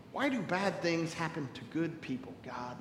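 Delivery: quiet aching question to God; hushed plea. Acted. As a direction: ask it under your breath.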